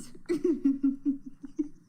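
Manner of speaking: a run of high giggles; giggling. Real, not acted. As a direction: giggle through the embarrassment. Laughing at ride clip.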